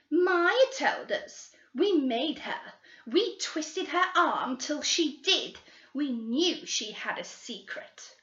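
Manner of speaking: put-on high, slow, gloating girl voice; smug girl. Girls boasting to the detectives how they forced Maya, high and gloating. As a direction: brag slowly about the secret.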